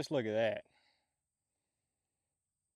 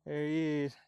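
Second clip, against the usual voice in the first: excited; 4 semitones higher, 5 dB louder than usual. Real, not acted. Spotting the downed turkey.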